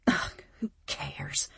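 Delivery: slow breathy mumble to herself. A narrator's reading. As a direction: mutter it under your breath, defeated. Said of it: "I mumble".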